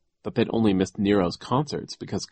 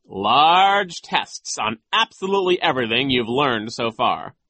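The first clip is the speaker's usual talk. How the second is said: nasty vice principal; 9 semitones higher, 5 dB louder than usual. A narrator's reading. Vice principal threatens exhausted students with expulsion, nasty. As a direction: lay down threats in a loud, high voice. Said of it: "nasty, mocking voice".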